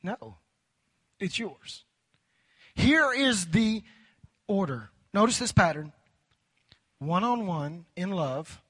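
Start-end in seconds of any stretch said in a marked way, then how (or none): 2.7-4.9 slow dramatic pause. Acted.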